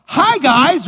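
high, loud, overly cheerful hi to the dogs; nervous friendly greeting. Retold or imitated. Pastor greeting seven loose German Shepherds, nervously cheerful and high. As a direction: try to sound friendly to scary dogs. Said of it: "And I'm like".

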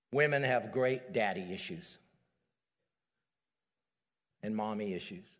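ordinary talk

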